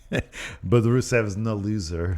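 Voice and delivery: put-on slow, botched Bulgarian accent; drawn-out Austrian-sounding fake accent; wrong mock accent. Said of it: "That's the wrong accent again".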